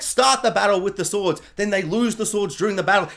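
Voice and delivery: usual speaking voice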